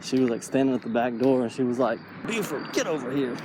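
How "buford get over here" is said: lightly voiced owner calling her dog. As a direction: call the dog in from the back door. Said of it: "like that".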